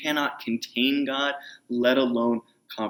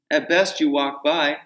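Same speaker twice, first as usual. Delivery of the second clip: usual speaking voice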